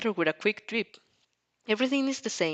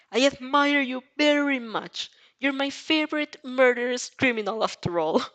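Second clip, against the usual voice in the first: higher, mock-admiring sarcasm; sarcastic; 6 semitones higher, 4 dB louder than usual. Girl fake-praising the murderer, sarcastic and higher. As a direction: gush falsely at him. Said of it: "a not-so-subtle layer of sarcasm".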